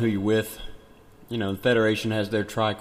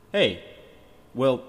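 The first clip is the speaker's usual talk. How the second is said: ordinary talk, nothing marked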